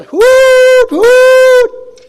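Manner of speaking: very high, loud hooting call; yelled hoot. Retold or imitated. Last shepherd yelling to his sheep, loud hoots. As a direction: hoot out long and loud to the flock. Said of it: "then he yelled".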